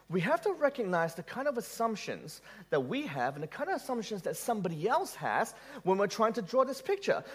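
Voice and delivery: ordinary talk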